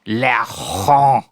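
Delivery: "Laurent" with a drawn-out throaty r and silent t; French pronunciation. Shown as a demonstration. Coaching Dad to copy it, "Laurent" in French pronunciation. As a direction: exaggerate the French "Laurent", nasal ending rising. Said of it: "Try it".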